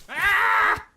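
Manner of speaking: high, loud panicked shriek; sharp real scream; startled scream. Real, not acted. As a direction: scream as it hits. Bear attacks again.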